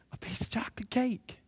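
breathy whispered aside; hushed, wondering thanks. Acted. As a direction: whisper it like a secret treat. Grateful aside about the cake.

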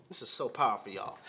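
quiet aside. Real, not acted. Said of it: "quiet emphatic aside".

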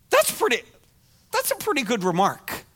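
ordinary talk, nothing marked

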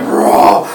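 loud mock monster roar. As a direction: roar like the conjured shadow beast.

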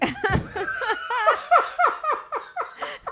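high shrieking laugh; real high cackle; burst of laughter. Real, not acted. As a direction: crack up at the story. Reacting to the punchline.

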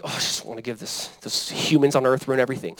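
low, very soft mock grumble; begrudging. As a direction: act out giving grudgingly, under your breath. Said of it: "God ever gives begrudgingly".

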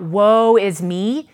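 slow, loud wail of despair; cry of despair. Retold or imitated. Preacher voicing the listeners' own despair, slow loud cry. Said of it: "Have you wanted to cry out".

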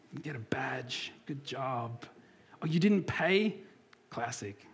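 hushed, breathy mock congratulation; soft sarcastic praise; mock whisper praise. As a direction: whisper it like a patronising pat on the head. Mocking praise for trivial things.

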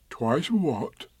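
a touch of a slow teddy bear voice; gentle bear asking a friend; mild Pooh voice; lightly voiced Pooh bear.

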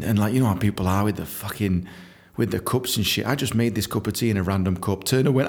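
ordinary talk

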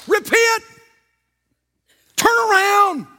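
high-pitched warning shout; urgent preaching cry. Shown as a demonstration. Preacher warning his congregation to repent, urgent shout.

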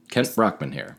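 lightly voiced TV anchor; a hint of a cheesy newsman; Kent Brockman. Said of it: "is Kent Brockman from The Simpsons".

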